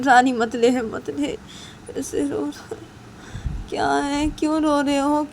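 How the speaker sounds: high, loud, coaxing a crying son; father begging the baby to stop crying; fretting father. Acted. Voices father soothing crying son.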